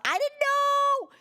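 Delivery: high, loud drawn-out wail; flustered outcry. Real, not acted. Streamer learning the collab is today, flustered high wail. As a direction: wail it in protest, stretched out.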